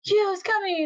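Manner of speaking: high, excited imitated announcement; Momiji. Bursting in with news of Kyo, excitedly.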